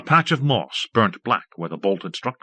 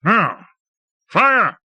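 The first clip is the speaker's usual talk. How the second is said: shouted order; 12 semitones higher, 5 dB louder than usual. A narrator's reading. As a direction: fire bellowed high and loud. Commanding the weapon to fire.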